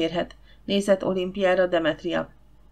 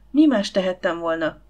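plain reading voice

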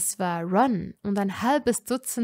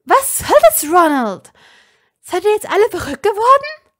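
much higher, loud, fast furious demand; angry outburst; 11 semitones higher, 13 dB louder than usual. A narrator's reading. Hermine confronting Ron over the arrest, furious. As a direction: hurl it at him in outrage.

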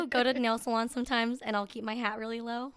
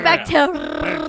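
loud, slow Spanish with long rolled R; exaggerated Spanish; 5 semitones higher, 15 dB louder, slower than usual. Showing bacteria in Spanish, exaggerated Spanish trill. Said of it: "How do you say bacteria in Spanish".

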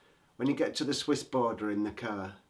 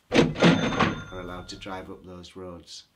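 louder, slow, mock-official reading; loud indignant reading; 4 semitones lower, 8 dB louder, slower than usual. Mocking the Swiss road sign, loud and slow. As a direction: read the sign like an outrage.

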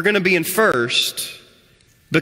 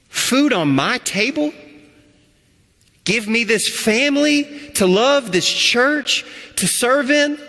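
slow, rising higher with each question in disbelief; amazed wonder; 4 semitones higher, slower than usual. Pretend. A forgiven sinner asking God in growing astonishment, slow and rising. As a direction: marvel that anyone would give you this. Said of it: "you start going".

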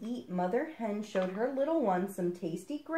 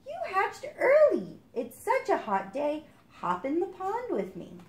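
higher, cheerful mother duck; higher mother duck inviting her duckling into the pond; 11 semitones higher, 4 dB louder than usual. Acted. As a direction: invite your duckling to swim.

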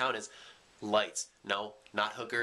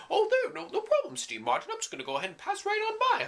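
much higher cartoon voice for the asteroid; talking asteroid; 4 dB louder than usual. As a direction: make the asteroid sound tiny and polite. Asteroid voiced in his story.

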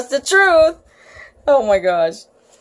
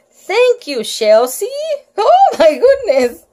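laughing; 10 semitones higher, 4 dB louder than usual. Real, not acted.

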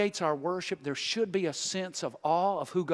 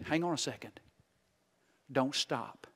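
softer, grave warning; very serious; 4 semitones lower, 7 dB quieter than usual. Acted. Barnabas warning the church, softly serious. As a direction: lower the voice and turn earnest. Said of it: "he says very seriously".

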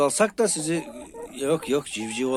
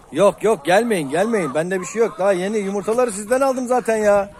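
shooing hens; 8 semitones higher, 5 dB louder than usual. Real, not acted. Waving off approaching hens.

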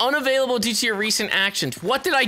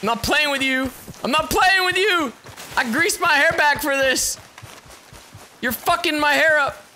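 raised, higher-pitched scolding that builds; indignant warning; 7 semitones higher than usual. Real, not acted. Player to a game character messing his hair, indignant warning. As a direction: build from firm warning to high protest.

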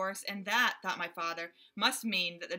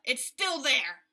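loud, higher, irritated gorilla calling monkeys; raging gorilla; 4 semitones higher, 8 dB louder, slower than usual. A narrator's reading. Gorilla yelling for his monkeys to catch a flea, loud. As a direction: bellow for help, itchy and fed up. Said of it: "said the gorilla".

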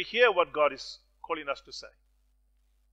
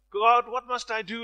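raised, pleading cry to God; 7 semitones higher, 7 dB louder than usual. Retold or imitated. Man clinging to a branch cries up to God, loud and pleading. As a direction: call up to God from the cliff, pleading. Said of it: "he cried out again".

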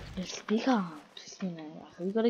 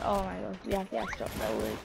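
ordinary talk

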